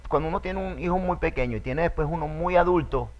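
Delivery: ordinary delivery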